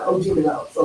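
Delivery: ordinary talk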